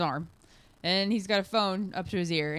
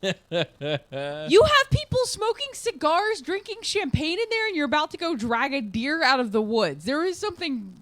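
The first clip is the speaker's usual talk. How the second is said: incredulous retold outburst; 9 semitones higher than usual. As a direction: act out stunned disbelief, pitched up. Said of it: "And I'm just like".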